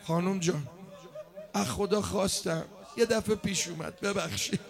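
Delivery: very soft, subdued apology; hushed confession. Acted. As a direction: confess it almost under the voice.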